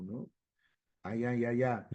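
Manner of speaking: normal talking voice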